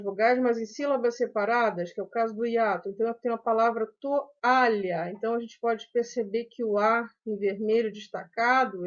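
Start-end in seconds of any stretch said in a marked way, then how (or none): 4.0-5.1 "toalha" as to, a, lha; separated syllables in "toalha"; split into syllables. Shown as a demonstration. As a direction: let the a stand alone in "toalha". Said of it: "ele é uma sílaba".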